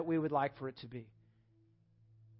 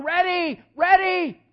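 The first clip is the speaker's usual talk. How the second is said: very high, loud stomping whine; childish high demand, much louder; impatient toddler; 12 semitones higher, 11 dB louder, slower than usual. Retold or imitated. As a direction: stamp your feet like a child. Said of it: "I'm stomping my feet going".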